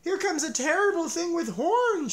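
high, loud rabbit yelling a warning; a rabbit shouting in terror; a high loud frightened shout; panicked shout. A narrator's reading. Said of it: "shouted a rabbit".

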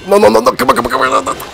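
high frantic pleading; being chased. Real, not acted. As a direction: panic as time runs out. Panicking in the last seconds.

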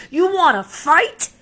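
high, loud taunt with a rising end; bully's dare. Acted. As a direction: dare him to fight. Grouchy ladybug picks fight.

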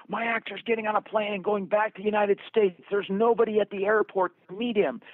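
high, urgent, loud voice of alarm; panicked caller. Retold or imitated. Frantic caller alerting the director, high and panicked. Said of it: "I've had people call me and say".

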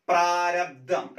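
പ്രാരബ്ധം said slowly with a short രാ, not പ്രാരാബ്ധം; slow careful demo. Shown as a demonstration. Teacher to exam students, slow clear പ്രാരബ്ധം. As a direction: stretch out പ്രാരബ്ധം with a short middle vowel. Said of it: "കണ്ടോ?".

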